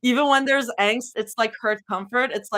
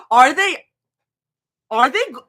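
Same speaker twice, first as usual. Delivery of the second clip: usual speaking voice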